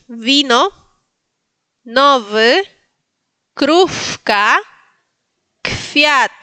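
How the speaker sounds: "Vino, nov" with buzzed V, "krufka, kfiat" with blown F; model pronunciation. Shown as a demonstration. Teacher models words for learners, separate and carefully sounded. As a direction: give each word separately, V in "Vino", F in "kfiat". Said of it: "lo vamos a pronunciar así".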